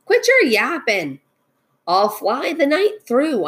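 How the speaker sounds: eagle's brusque storybook call; calling back. A narrator's reading. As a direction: toss it back scornfully to a pursuer. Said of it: "over his shoulder called back".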